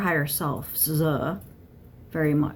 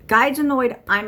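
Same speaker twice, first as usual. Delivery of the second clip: louder, higher burst of real annoyance; pissed off; 5 semitones higher, 6 dB louder than usual. Real, not acted. Channeler telling listeners the guide is annoyed too, pissed off. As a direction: let real irritation jump out loudly. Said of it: "now I'm pissed".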